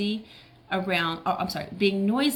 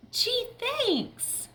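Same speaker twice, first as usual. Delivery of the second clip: very high, tiny-voiced gratitude; squeaky thanks. Little friend thanking for the cookie, very high squeak.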